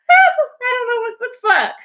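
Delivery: high, whiny, half-crying mock wail; mock sobbing high voice; dramatic meltdown. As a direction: ham up the dramatic girl. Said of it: "Be like".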